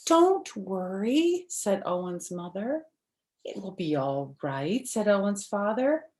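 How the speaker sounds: bright, lively storybook read-aloud; reading to children. Acted. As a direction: read it like story time for little kids. Reads picture book to children.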